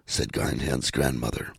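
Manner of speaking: ordinary delivery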